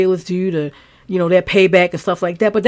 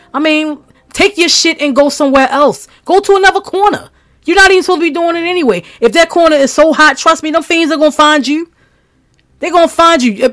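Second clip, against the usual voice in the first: higher and louder, real anger building; angry outburst; 8 semitones higher, 5 dB louder than usual. Real, not acted. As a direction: let real anger rise as you tell the dealer off. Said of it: "emotional and loud".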